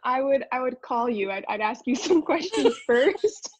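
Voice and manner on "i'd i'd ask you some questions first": laughing through the words, higher pitch; amused, breaking into a laugh. Real, not acted. Amused at being asked for accent.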